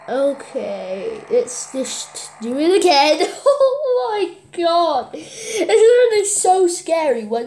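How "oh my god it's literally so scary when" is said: high laughing through fear; nervous laughter. Real, not acted. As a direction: laugh it off, shaken.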